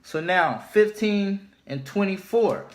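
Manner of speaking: ordinary delivery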